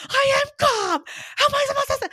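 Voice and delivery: very high, loud mock shriek; hammed-up hysterical protest; retold hysterics. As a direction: insist at the top of your voice, anything but calm. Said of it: "you have to calm down".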